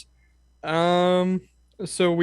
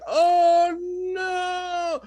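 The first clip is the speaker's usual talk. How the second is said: much higher, thin falsetto "Oh no"; mock dying cry. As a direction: squeak it out like a defeated knight. Dying knight's line.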